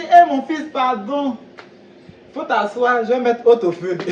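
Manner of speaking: high, loud motherly seller calling him son; high, loud market woman voice; fish seller woman. Retold or imitated.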